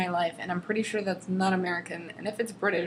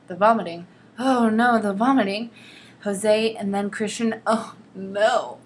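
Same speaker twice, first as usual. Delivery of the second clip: louder, hammed-up lament; mock dramatic dread; 6 dB louder than usual. Reading the hangover scene aloud, she hams up loud mock dread. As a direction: ham up the horror of each "oh no".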